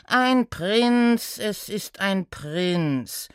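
drawn-out, weary answer; exasperated centaur. Acted. Centaur answering the guessing gnome, mildly exasperated. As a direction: stop the guessing, slowing right down.

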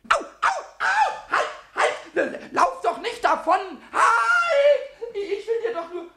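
loud, very high cries of pain and panic; alarmed shrieking. Acted. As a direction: yell after the escaping mouse. Bitten, the mouse escapes. (schrilles, erschrockenes Schreien)

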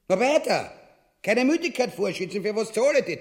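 impatient. Acted. King drives the scribe on.

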